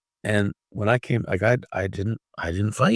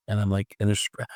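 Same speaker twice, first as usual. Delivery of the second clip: ordinary talk, nothing marked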